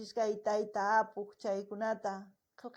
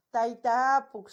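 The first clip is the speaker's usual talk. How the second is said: louder call to the singer by name; 10 dB louder than usual. Real, not acted. Streamer calling out to the singer [name], loud.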